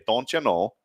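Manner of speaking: short demo of a Fargo accent; rounded Fargo-accent vowels. Shown as a demonstration. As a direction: say it like a Fargo local. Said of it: "Let's say it with that accent first".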